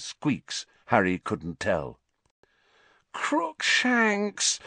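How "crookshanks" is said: a higher, dismayed moan of the name. A narrator's reading. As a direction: plead in distress. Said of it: "Hermione moaned".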